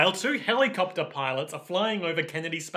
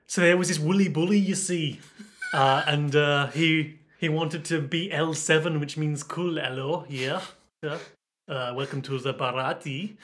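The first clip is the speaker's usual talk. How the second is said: Liverpool accent with a French layer. Shown as a demonstration. Demonstrating a layered impression for a co-host, Liverpool-French accent. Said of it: "the same impression that I always do of the Beatles".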